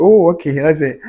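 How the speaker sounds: loud, high burst of surprise before laughing; surprised outburst. Real, not acted. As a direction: blurt it out caught off guard, then laugh. Reacting loudly to a viewer comment.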